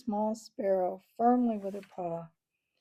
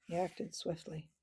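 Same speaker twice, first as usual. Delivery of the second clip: plain reading voice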